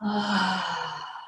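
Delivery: long, open exhaled "ahh" sigh; letting-out sigh. Shown as a demonstration. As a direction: demonstrate a relaxing sigh, letting the breath go. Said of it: "let it out with a sigh".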